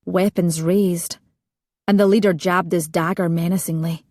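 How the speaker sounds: plain reading voice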